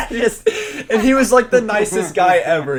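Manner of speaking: high, loud laughing delivery. Real, not acted. Storyteller amused by the curt introduction, laughing. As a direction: crack up while trying to go on. Cracks up at own anecdote.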